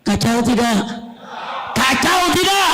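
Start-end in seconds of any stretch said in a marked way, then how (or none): 1.8-2.7 high-pitched call to the crowd; rousing shout. Real, not acted. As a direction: shout the question to get a response. Repeats question to rouse crowd. (seruan lantang bernada tinggi)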